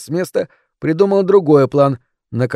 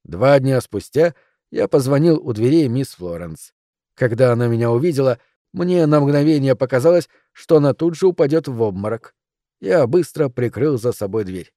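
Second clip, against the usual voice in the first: ordinary delivery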